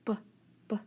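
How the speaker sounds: "B" as a quick "buh"; brief, separated "b" bursts; isolated letter sound. Shown as a demonstration. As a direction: tap out "b" twice as a sound. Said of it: "we're gonna try our spelling sounds".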